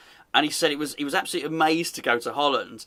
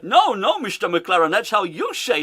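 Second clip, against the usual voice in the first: higher mock Dutch accent; mock Dutch interviewer; 4 semitones higher, 4 dB louder than usual. Interviewer correcting the manager, mock Dutch accent. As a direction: correct him in hammed-up Dutch-English. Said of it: "She said".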